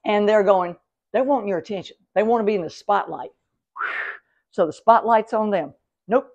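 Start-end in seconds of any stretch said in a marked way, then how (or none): none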